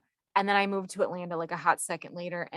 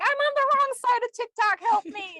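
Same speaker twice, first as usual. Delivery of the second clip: much higher, fast, mock-panicked plea; panicked user quote; 5 dB louder than usual. Podcast host mimicking a panicked TikTok user, high mock plea. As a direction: squeal it like a lost, scared user.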